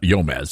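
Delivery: Yomez, opening on a Y instead of a hard G; wrong-way Yomez, Y at the front; mispronounced G. Shown as a demonstration. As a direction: say Yomez with a Y sound for the G. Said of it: "the G is not silent".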